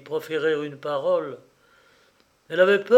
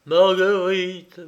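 put-on old father's drawn-out, raised quaver; slow, louder, higher, faltering call; quavering stammer; 4 semitones higher, 7 dB louder, slower than usual. A narrator's reading. Old father answering his weeping daughter, quavering stammer. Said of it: "balbutia ce dernier d'une voix chevrotante".

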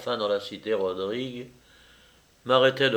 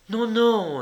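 high-voiced insistent woman; voiced woman insisting, pitched well up; 11 semitones higher, 3 dB louder than usual. A narrator's reading. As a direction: cut in high, pressing the point. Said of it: "insista Juliette".

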